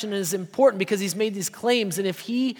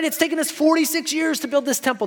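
a mock-incredulous protest, pitched much higher; freaking out; 8 semitones higher than usual. Temple leaders protesting to Jesus, higher incredulous outburst. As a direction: play the outraged temple leaders, voice shooting up. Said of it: "They're freaking out at this point".

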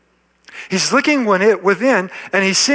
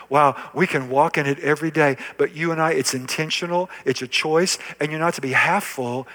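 usual speaking voice

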